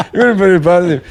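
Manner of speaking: says it through a laugh; chuckling as he repeats the jab. Real, not acted. Host repeating the jab about Devlin, laughing.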